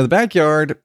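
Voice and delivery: ordinary delivery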